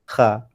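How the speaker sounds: kha with thin open a; kha, light, not thick; wrong kha. Shown as a demonstration. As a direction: show the common Malay kha. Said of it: "Orang Melayu banyak sebut kha".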